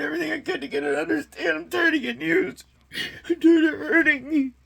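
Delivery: real tears pushing through; voice breaking. Real, not acted. Retelling begging his partner to understand, near tears.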